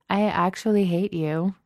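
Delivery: playful mock hatred; teasing. A narrator's reading. Genevieve needles her friend over lunch, teasing. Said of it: "Genevieve teases".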